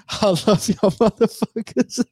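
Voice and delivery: high, slow, laughing between the words; laughing through it. Real, not acted. Vlogger telling viewers he loves them, laughing. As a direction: say it with love, laughing through tears.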